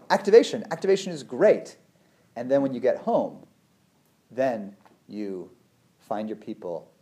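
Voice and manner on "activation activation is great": high, loud gleeful chant; mock excited cheer. Running from an attacker, cheering activation with mock glee. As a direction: cheer it on like good news.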